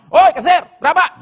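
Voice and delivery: loud, high yell to the cashier; rude shout. Acted. Waiter yelling rudely to the cashier about the bill. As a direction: holler across the room.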